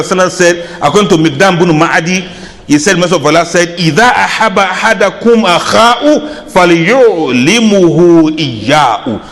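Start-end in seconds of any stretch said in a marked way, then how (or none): 3.8-9.2 hadith recitation. Acted. Quoting hadith in Arabic.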